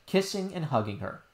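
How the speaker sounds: ordinary delivery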